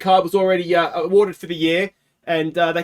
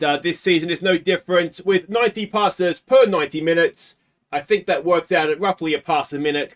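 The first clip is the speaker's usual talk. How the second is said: normal talking voice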